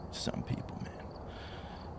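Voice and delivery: breathy under-the-breath whisper; whispered aside. Real, not acted. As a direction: mutter it to yourself, exasperated. Muttered aside.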